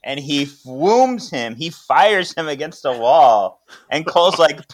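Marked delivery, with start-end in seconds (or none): none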